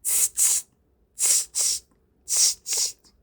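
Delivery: voiceless "tst" monkey chatter. A narrator's reading. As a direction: mimic monkeys with sharp little tongue-and-teeth noises. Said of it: "the monkeys only stamped their feet back at him".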